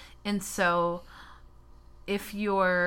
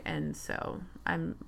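low, unvoiced backpedaling mumble; trailing off quietly; 6 dB quieter than usual. Real, not acted. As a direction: fade out into a sheepish mumble. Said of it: "I'm generalizing and making a joke".

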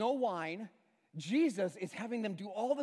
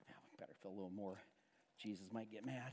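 fast, much softer and lower, almost under the breath; hushed worried servants; 12 semitones lower, 18 dB quieter, faster than usual. Retold or imitated. Servants fretting that Jesus might get mad, hushed and quick. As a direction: rush it out low like nervous servants.

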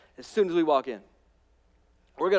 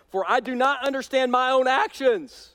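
raised preaching voice reading scripture; 8 semitones higher, 5 dB louder than usual. Acted. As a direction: read Paul's words with rising fervour. Preacher reading Paul aloud.

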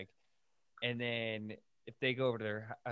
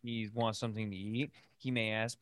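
ordinary talk, nothing marked

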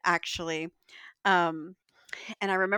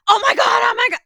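high, loud fangirl squeal; screaming high with excitement at a celebrity; starstruck squeal; 13 dB louder, faster than usual. Retold or imitated. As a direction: shriek like a starstruck fan. Fans recognizing her.

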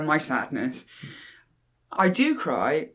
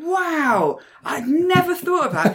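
much higher amazement; amazed; 11 semitones higher, 4 dB louder, slower than usual. Real, not acted. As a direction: sound truly struck. Mind blown.

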